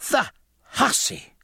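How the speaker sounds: very high, drawn-out outrage; high-pitched indignant exclamation; Missus Thomas crying out. A narrator's reading. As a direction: cry it slowly in a high, scandalised voice. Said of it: "cried Missus Thomas".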